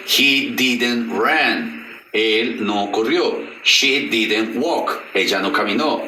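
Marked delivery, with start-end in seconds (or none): 0.1-1.8 "I didn't work" said very slowly, each word apart; slow model sentence. Shown as a demonstration. Teacher models "I didn't work" slowly for students. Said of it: "Did ya me indica que es una negación". 3.6-5.0 "I didn't work" said very slowly, each word apart; slow model sentence. Shown as a demonstration. Teacher models "I didn't work" slowly for students. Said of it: "Did ya me indica que es una negación".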